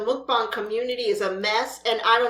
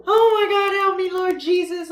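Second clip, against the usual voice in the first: higher, louder appeal to heaven; exasperated plea; 6 semitones higher, 3 dB louder than usual. Real, not acted. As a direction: throw your hands up vocally.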